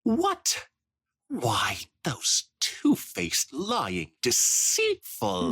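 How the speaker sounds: shocked squeak on what, then slow indignant insults; outraged society mare. Acted. Spoiled Rich outraged at the spa ponies, slow indignant sputter. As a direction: sputter with snobbish fury.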